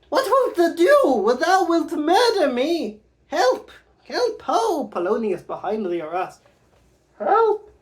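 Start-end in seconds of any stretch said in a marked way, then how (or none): 0.1-4.9 much higher, louder, slow panicked pleading; terrified queen. Acted. Gertrude fears murder. 7.2-7.7 high, loud cry for help; panicked cry. Acted. Polonius behind arras.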